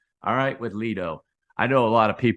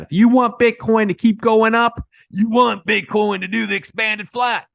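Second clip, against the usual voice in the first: much higher and louder, hyped; excited; 12 semitones higher, 9 dB louder than usual. Real, not acted. YouTuber hypes viewers on Bitcoin's expanded flat, loud and excited. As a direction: really sell the bullish case.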